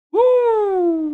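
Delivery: high, loud sarcastic whoop; sarcastic celebration for the C plot; mock cheer. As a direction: whoop with fake excitement.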